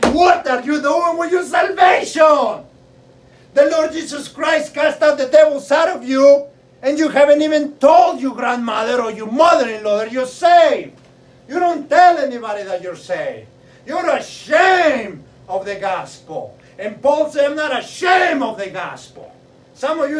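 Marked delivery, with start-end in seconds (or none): 0.1-16.6 loud, high, shouted preaching; full-force pulpit shout. Real, not acted. Preacher's peak, shouting at congregation. 17.5-19.3 loud declaration of faith; Paul declaring at full voice; shouted quote. Retold or imitated.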